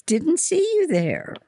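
high-pitched chirpy voice; breezy, sing-song cheer; cheerful. A narrator's reading. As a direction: pipe up cheerily, well above normal pitch. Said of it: "said in a cheerful voice".

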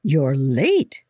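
mildly cross matron; scolding. A narrator's reading. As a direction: scold a child for lateness. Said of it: "Mother Anderson scolded".

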